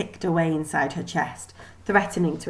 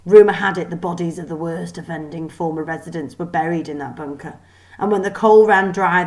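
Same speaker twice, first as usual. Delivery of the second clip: plain reading voice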